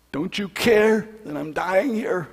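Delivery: high, slow pleading cry; anguished cry. Retold or imitated. Widower alone in the field crying out to God, anguished plea. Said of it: "in the field saying".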